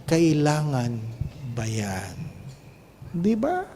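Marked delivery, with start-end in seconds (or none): 0.1-2.1 quiet self-question. Shown as a demonstration. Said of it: "Itanong mo muna".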